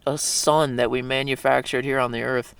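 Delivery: ordinary talk, nothing marked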